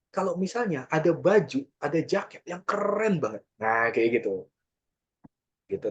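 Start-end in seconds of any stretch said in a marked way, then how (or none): 0.1-3.4 high, excited rave with punch on "keren"; excited pitch. Shown as a demonstration. Content creator raving about a cool jacket, excited. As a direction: gush like you've found an amazing find. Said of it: "bawainnya sambil excited".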